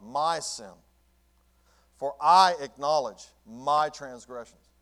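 preacher reading David's psalm aloud; forceful scripture reading. Acted. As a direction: proclaim the verse slowly.

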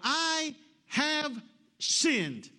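very slow, high, loud confession; crying out. Acted. Caught King Saul confessing to Samuel, crying out. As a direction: cry out each word, stretched long. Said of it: "Saul cries out to Samuel".

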